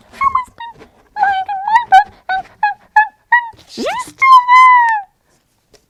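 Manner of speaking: shrieking tiny bear, tearful; baby bear wailing in alarm; little high Baby Bear. Acted. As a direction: squeal it very high and loud, stammering. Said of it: "little high voice".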